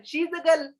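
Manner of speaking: high-pitched mimicry, girl said as "gal"; mispronounced girl. Teacher imitating learners' mistake, high voice with girl as "gal". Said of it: "हम लोग क्या बोलते हैं?".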